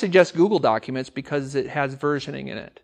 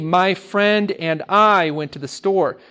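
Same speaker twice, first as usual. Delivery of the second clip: slow, careful model sentence with stress on "I"; 3 semitones higher, 3 dB louder, slower than usual. Shown as a demonstration. Teacher models the correct sentence slowly for students. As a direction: spell out "My friend and I" slowly. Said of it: "So it will be".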